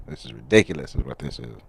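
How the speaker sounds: quick, put-on English accent. Complaining about the dry Sharpie in a mock English accent. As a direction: grumble it as a fussy Englishman. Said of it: "why am I talking in an English accent?".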